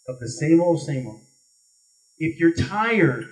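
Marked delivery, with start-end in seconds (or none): 0.8-1.2 put-on softer throwaway repeat of "same old", trailing low; quiet echo. Echoing himself to the crowd, quieter. Echoed phrase, dropped low. 2.2-3.3 drawn-out, weighty "So if you're tired", each word held; slow emphatic. Acted. Speaking to the crowd, slow and deliberate. Rhetorical build in stump speech.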